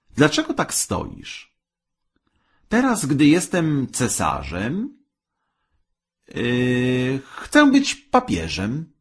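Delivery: high-pitched, drawn-out imperious demand; lofty and insistent, pitched well up; demanding wife. A narrator's reading.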